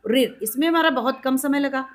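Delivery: normal talking voice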